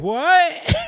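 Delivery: high startled "What?"; shocked squeak. Retold or imitated. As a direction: yelp it in disbelief. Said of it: "everybody's like".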